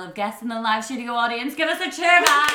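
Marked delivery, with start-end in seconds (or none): 1.5-2.5 loud, high call for a cheer; rousing call. Real, not acted. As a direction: whip up the studio audience. Host calls to live audience.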